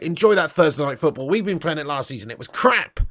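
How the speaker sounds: ordinary talk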